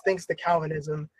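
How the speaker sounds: dry sarcastic aside. Real, not acted. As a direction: say it with quiet sarcasm. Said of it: "I'm being sarcastic with that".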